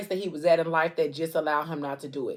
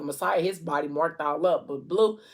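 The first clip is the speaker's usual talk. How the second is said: ordinary talk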